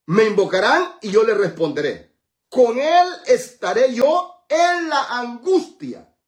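high, loud, stretched-out declaration; preacher hammering out each phrase of the verse; proclaiming scripture. Acted. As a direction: build to a slow, ringing cry.

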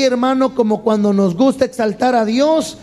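normal talking voice

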